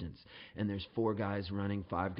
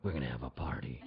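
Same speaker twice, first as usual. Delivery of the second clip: low federale voice, softer; mock tough cop; 9 semitones lower, 5 dB quieter than usual. Federale answers about tonight's plans, low mock-cop voice. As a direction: drop low for the cop's answer.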